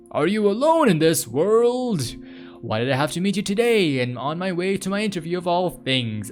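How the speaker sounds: high, theatrical plea to a stray cat; hammed-up heartfelt pleading; melodramatic lament. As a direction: pour out mock anguish, voice lifted.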